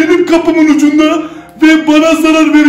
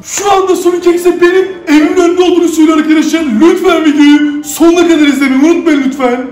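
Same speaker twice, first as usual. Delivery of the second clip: ordinary talk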